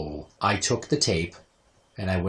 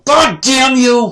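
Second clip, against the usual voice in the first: high, drawn-out furious holler; imitating an outraged scream; startled mock yell; 11 dB louder, much slower than usual. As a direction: shriek it like a mother ambushed by an air horn. Mother startled by air horn.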